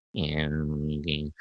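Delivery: very low, quiet mumble; struggling job applicant. Retold or imitated. Applicant from Goa to the recruiter, quiet low mumbled agreement. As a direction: imitate an applicant barely following the English. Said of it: "their English is so poor".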